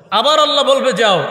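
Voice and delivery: rising sermon emphasis; emphatic call. Acted. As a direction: push the word out strongly. Preacher building the third command.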